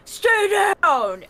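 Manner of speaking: high, loud wrestler's command; shout. Acted. Wrestler laying opponent on the ground, acted shout. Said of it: "and shout at him".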